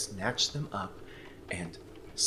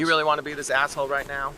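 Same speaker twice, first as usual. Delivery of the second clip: annoyed snap; 3 semitones higher, 7 dB louder, much faster than usual. Acted. Annoyed retort in argument.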